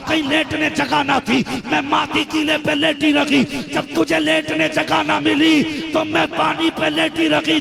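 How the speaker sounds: loud, high impassioned preaching; preaching at full force. Acted. Preacher voicing the mother reproaching her son, impassioned. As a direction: build the mother's reproach to a fervent climax.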